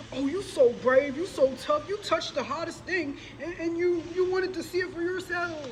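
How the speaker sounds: high, sing-song taunting praise; mocking flattery.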